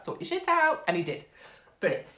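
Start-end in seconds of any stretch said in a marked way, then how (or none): 0.0-0.8 high-pitched, exasperated order; mock exasperated telling-off. Fan telling the striker to start scoring, high mock exasperation. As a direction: scold him in mock despair.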